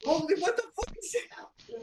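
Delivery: very high-pitched astonished cry; shocked. Acted. Bunch of people looking over at stranger, shocked. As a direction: squeal it in disbelief.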